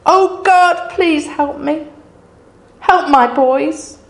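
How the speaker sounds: high, loud, anguished pleading to her dead sons; a mother crying out over her sons' bodies. Retold or imitated. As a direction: beg God through the grief. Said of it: "I imagine her crying out to them".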